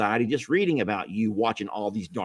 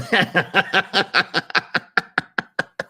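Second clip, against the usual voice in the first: high helpless burst of laughter; real loud cracking-up laugh; 9 semitones higher, 3 dB louder than usual. Real, not acted. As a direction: break up laughing. Cracks up on camera.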